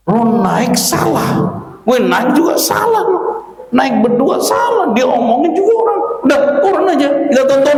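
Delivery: a fed-up father telling his son to get off the camel, angrily; angry father. Acted. Father scolding son over the camel, exasperated. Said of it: "Marah-marah bapak turun".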